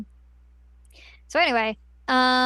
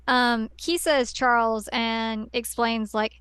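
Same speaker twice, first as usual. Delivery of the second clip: normal talking voice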